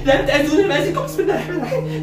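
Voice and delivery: high frightened babbling; panicked pleading. Acted. Girl begging the spirits not to harm her, panicked pleading. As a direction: gabble prayers in rising terror.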